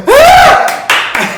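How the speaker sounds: high, loud excited shriek of a crowd; mock audience scream. Audience reacting to the singer, high loud shriek.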